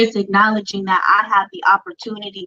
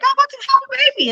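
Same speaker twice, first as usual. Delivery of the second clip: much higher, rushed, fast crying wail; retold sobbing; much faster than usual. Daughter telling her mom about the pregnancy, fast high wail. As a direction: blurt it out fast as if bawling. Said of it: "bawling my eyes out".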